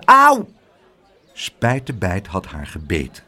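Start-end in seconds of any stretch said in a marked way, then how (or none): 0.0-0.5 high, loud scream; yelp of pain. A narrator's reading. Pastry lady bitten by the child, high yelp. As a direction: cry out as if suddenly bitten. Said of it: "gilde ze".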